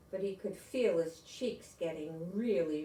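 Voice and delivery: plain reading voice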